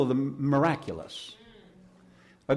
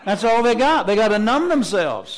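raised preaching voice, higher; preaching loud; 7 semitones higher, 4 dB louder than usual. Real, not acted. Preacher booming to church about worldly numbing. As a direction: press it on the congregation.

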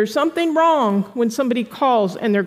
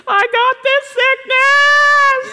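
high, drawn-out whining voice, slow; mock a self-pitying caller; whiny complaint; 13 semitones higher, 5 dB louder, much slower than usual. Preacher mocking a sick caller, whiny and high. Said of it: "Stop calling the prayer line and saying".

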